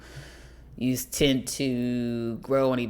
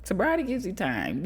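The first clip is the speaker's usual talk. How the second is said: ordinary talk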